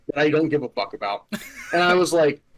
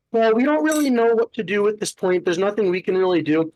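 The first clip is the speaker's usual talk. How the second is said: higher, sing-song mock of a helpless clerk; mocking the office staff; 6 semitones higher than usual. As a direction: lightly mimic a shrugging office worker.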